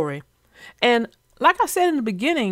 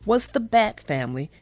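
put-on gentle storyteller reading to kids; story narration; 2 semitones lower, 5 dB quieter than usual. A narrator's reading. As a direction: read it like a bedtime picture book. Reads children's story aloud.

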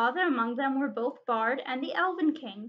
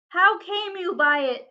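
raised, louder angry demand; gathering rage; 5 semitones higher, 7 dB louder than usual. A narrator's reading. Dwarf king demands answers about the stone, shouted in rage. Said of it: "shouted Thorin in gathering rage".

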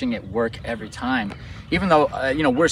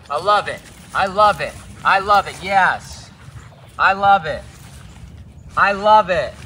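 higher, louder, gushing praise; excited praise; 8 semitones higher, 5 dB louder, slower than usual. Real, not acted. Trainer gushing over her dog's recall, excited. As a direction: cheer the dog on with delight. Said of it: "See, I'm acting a little different now".

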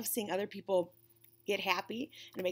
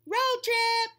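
high, loud happy shout; excited cry; 13 semitones higher, 7 dB louder than usual. Acted. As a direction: call it out like a thrilled kid. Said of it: "cried Pete".

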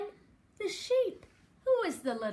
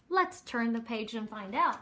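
ordinary delivery, nothing marked

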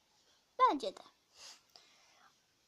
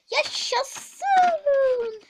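cheering; 10 semitones higher, 12 dB louder than usual. Acted. Child cheers permission.

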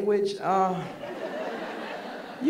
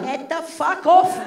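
put-on high, slow, dismissive old lady voice; old Sicilian woman; 6 semitones higher, slower than usual. Old fan turns on a Neapolitan, old Sicilian woman voice. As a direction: draw it out as a disgusted elderly Sicilian. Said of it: "an eighty-year-old Sicilian came up to me".

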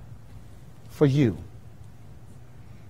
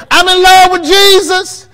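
much higher, loud gushing voice; mock gushing believer; 8 dB louder than usual. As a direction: swoon it out. Miss Holloway telling people.

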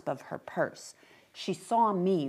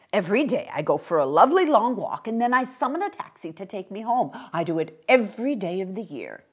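put-on quick, louder old man's voice; chatty little old gentleman; 3 semitones higher, 5 dB louder, faster than usual. Old man explains his daily walk, brisk and chatty. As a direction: rattle it off cheerily, quickly.

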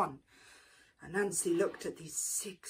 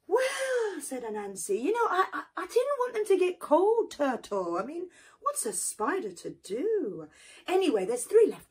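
sly excuse; 9 semitones higher, 4 dB louder than usual. Acted. Anansi makes excuses.

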